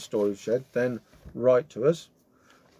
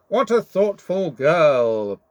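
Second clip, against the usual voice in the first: slow, louder purring wolf voice; 5 semitones higher, 7 dB louder, slower than usual. Acted. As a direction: purr it like a sly wolf. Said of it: "purred the wolf".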